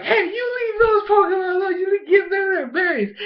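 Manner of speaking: high-pitched little scolder voice; Bonnie scolding. Retold or imitated. Bonnie scolding the bully, acted.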